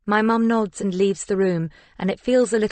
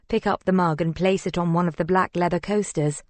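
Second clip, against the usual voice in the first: plain reading voice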